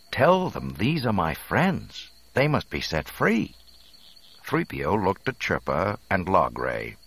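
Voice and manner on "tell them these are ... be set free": higher-pitched, urgent appeal; earnest demand. A narrator's reading. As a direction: plead for your friends' release. Leia pleads for captive friends.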